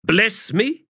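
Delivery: sharp, high-pitched disbelief; sharpened voice. A narrator's reading. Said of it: "Paran's voice sharpened".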